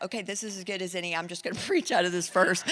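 quick, soft, resigned aside; resigned mutter. Retold or imitated. Nervous preacher, resigned, fast and soft. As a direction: shrug it off under the breath, fast. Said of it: "he's like".

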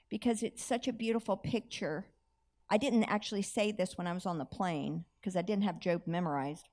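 ordinary delivery

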